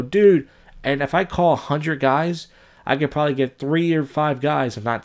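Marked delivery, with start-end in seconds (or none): 0.0-0.5 higher and louder pumped-up "Dude"; mock hyped bro greeting. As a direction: play a scammer cold-calling a stranger. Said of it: "call random dudes who post their number and go".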